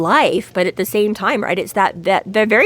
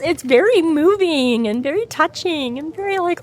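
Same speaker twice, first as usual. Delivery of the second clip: ordinary talk, nothing marked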